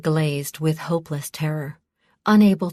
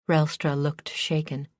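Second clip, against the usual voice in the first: usual speaking voice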